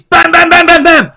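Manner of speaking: loud, high rapid-fire bams; rapid popping sound. Retold or imitated. Mimics babies popping out rapidly.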